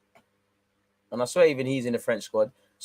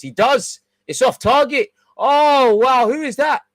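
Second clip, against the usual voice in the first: loud and high, shocked at a missed chance; excited outburst; 12 semitones higher, 9 dB louder, slower than usual. Real, not acted. Fan watching a missed shot, loud and amazed. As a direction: blurt it out, astonished.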